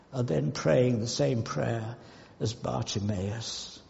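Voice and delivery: ordinary talk, nothing marked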